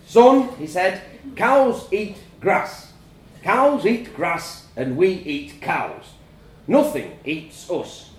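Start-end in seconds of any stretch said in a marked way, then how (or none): 0.1-0.5 high, loud incredulous dad. A narrator's reading. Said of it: "looked at me incredulously". 1.4-8.1 slow, emphatic dad explaining; incredulous. A narrator's reading. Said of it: "looked at me incredulously".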